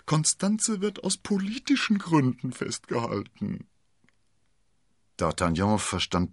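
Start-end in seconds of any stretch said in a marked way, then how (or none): 0.0-3.7 high, secretive landlord; theatrically conspiratorial husband; confiding stage whisper. A narrator's reading. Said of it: "flüsterte er".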